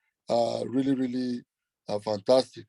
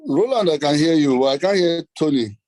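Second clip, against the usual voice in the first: louder, over a bad call line; speaking up; 8 dB louder than usual. Real, not acted. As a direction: raise your voice so they catch it. Calling out over bad connection.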